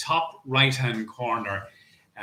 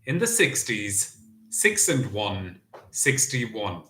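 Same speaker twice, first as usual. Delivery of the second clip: bingo caller. Acted. Bingo number calling.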